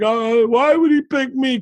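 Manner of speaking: high-pitched mock whine; crying, freaking out. Retelling Verbal breaking down under questioning, high mock-crying. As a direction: imitate a man pleading in tears. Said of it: "He's crying".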